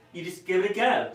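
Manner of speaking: lightly put-on Australian accent. Passing on an Australian friend's advice to Catherine, Australian accent. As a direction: give it a light Australian twang. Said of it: "that's my, uh, Australian accent".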